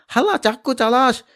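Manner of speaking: high, loud snarl of a thug; angry yell. Acted. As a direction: spit it out mid-fight. Attacker shouting in fight.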